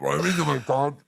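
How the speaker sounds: ordinary delivery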